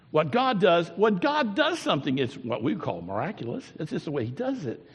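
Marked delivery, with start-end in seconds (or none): none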